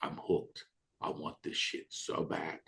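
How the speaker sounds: low unvoiced confession; whispering his need for it; whispered craving. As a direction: whisper it, hushed and hungry. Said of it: "And I was like".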